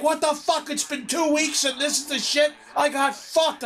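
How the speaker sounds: high-pitched furious protest, volume held back; retold screaming. Answering the manager telling him to calm down, retold screaming. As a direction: act out losing it in a mock scream. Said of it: "I start screaming".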